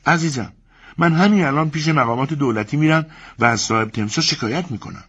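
resolute, ignoring the others; wife vowing to complain, high and resolute; Elena. Acted. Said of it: "النا ... گفت".

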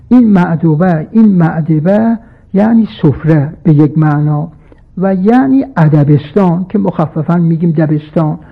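normal talking voice

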